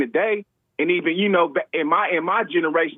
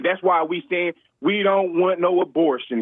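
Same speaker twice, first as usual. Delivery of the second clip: usual speaking voice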